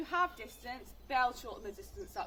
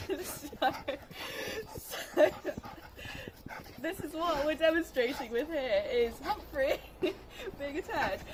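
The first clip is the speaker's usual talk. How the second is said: laughing; 5 semitones higher, 4 dB louder, slower than usual. Real, not acted. Laughing at dog misbehaving.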